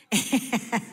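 short amused laugh; light laugh. Real, not acted. Laughing after her answer.

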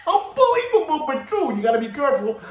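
high, laughing unbelievable; giggling high catchphrase. Real, not acted. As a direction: laugh it out in disbelief.

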